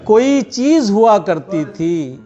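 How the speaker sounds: measured Urdu poetry recitation; tender romantic poetry. Acted. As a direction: recite each couplet with feeling. Poet reciting at mushaira.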